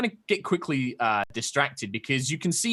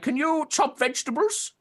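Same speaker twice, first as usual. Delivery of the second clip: put-on high, curious old turtle creature voice; a wary hermit peering at strangers; old turtle man; 13 semitones higher than usual. GM voicing the old turtle hermit to the party.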